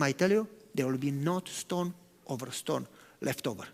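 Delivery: grave prophecy. Retold or imitated. Jesus answering the proud disciples, quiet and grave. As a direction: speak the doom quietly.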